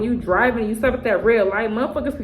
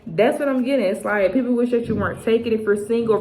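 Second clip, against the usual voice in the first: normal talking voice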